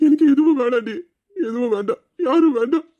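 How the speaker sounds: sobbing out the words to his wife; crying. Acted. Husband breaks down crying. (அழுகையுடன் உரக்க)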